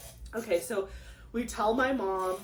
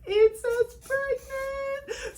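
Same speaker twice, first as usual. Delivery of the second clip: much higher, slow, tearful reading; much slower than usual. Real, not acted. Woman reading the positive test, tearful and slow. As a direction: choke it out in happy tears.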